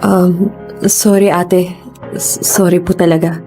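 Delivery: slow, halting, guilty sorry; subdued, faltering remorse; hesitant apology. Acted. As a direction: stammer an apology to big sister. Sister apologizes haltingly after the assault.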